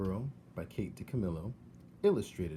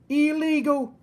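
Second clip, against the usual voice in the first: very high, loud shout back; 13 dB louder, slower than usual. Acted. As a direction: yell it back at him. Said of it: "shouted Mig back".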